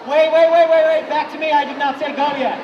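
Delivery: loud, urgent call back to attention. Real, not acted. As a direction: stop the room, loudly. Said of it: "calling class back to attention".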